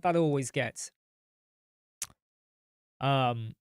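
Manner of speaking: ordinary talk, nothing marked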